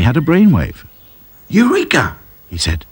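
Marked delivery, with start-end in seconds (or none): 1.5-2.2 excited. A narrator's reading. Sudden brainwave cry.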